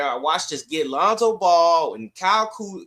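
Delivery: normal talking voice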